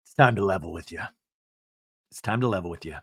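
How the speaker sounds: low, soft, serious; level with them gravely; lightly voiced solemn husband; mock earnest. [name] serious, taking glasses off.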